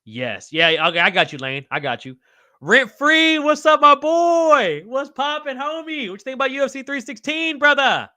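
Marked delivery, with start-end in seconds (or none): 2.6-8.1 excited shout-out. Real, not acted. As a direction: hype up the viewer who just joined. Greeting viewer Rent Free in chat.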